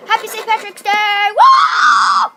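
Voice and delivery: high, loud festive whoop; excited cheer. Real, not acted. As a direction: cheer it out big. Holiday cheer.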